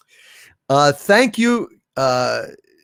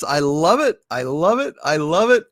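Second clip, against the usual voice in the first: ordinary talk, nothing marked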